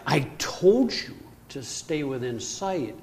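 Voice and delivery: slow, stern telling-off; angry scolding. Retold or imitated. Angry husband scolding wife and daughter for drifting off. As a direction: scold them for paddling out of sight. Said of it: "And I am pissed".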